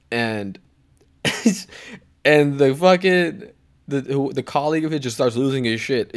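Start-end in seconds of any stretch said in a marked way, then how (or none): none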